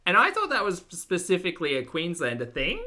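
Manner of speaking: high, loud upspeak with "thing" swept upward; Australian question intonation. Shown as a demonstration. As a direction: make the statement a question on "thing". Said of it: "Australian question intonation".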